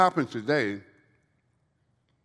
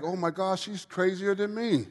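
amused disbelief; 11 semitones higher than usual. Retold or imitated. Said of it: "I was like".

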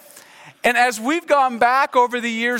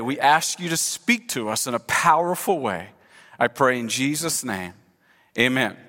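lower-pitched, reverent public prayer; 5 semitones lower than usual. Real, not acted. Pastor praying before the message, in a lowered prayer voice. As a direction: lead the congregation in measured prayer.

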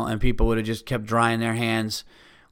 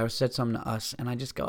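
normal talking voice

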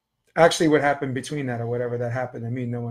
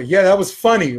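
louder, higher mocking call; retold taunt; 4 semitones higher, 7 dB louder than usual. Teen calling back at the stranger as he walks off, taunting. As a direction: throw a sarcastic jab from a distance.

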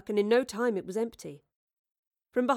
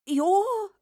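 a much higher, louder gasp of a start; starstruck; 12 semitones higher, 7 dB louder than usual. Acted. Starstruck at Shakespeare.

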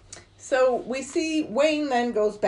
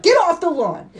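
high, loud shouted order; mock yell; 10 semitones higher, 7 dB louder than usual. Movie dad yelling at neighbours on the lawn, mock shout. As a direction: imitate him yelling from across a yard. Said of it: "Yelling at them".

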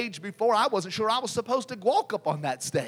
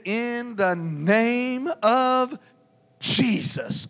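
very slow, drawn-out invocation; commanding invocation; 5 semitones higher, 4 dB louder, much slower than usual. Retold or imitated. Group gathered round the man invokes the name, slow command. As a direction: stretch every word before the name.

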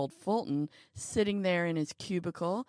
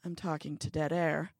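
ordinary delivery, nothing marked

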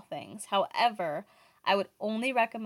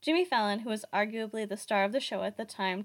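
normal talking voice

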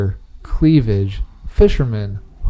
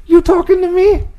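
much higher, bad Italian accent; worst Italian accent. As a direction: ham up a tough Italian-American taxi driver. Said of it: "That was the worst Italian accent ever".

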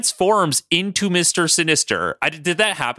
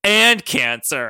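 AND CANCER raised and stretched out; drawn-out emphasis; 4 semitones higher, much slower than usual. Acted. Sinister adds one more cure, "and cancer" drawn out emphatically. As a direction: lift and drag "and cancer" as a big addition. Comic tag on villain's speech.

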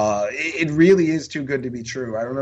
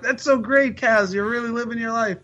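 high-pitched mock-gushing praise; gushing encouragement; 11 semitones higher than usual. Replying to an excited British fan, high gushing encouragement. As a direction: cheer him on in a high, indulgent voice.

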